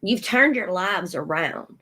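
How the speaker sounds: normal talking voice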